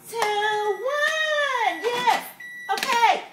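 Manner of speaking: high, loud countdown and cheer; sung countdown to the popcorn; excited cheering. Acted. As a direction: cheer the timer out.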